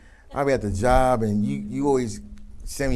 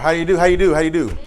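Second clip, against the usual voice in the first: excited greeting; 6 semitones higher, 10 dB louder than usual. Real, not acted. Exuberant greeting after role-play.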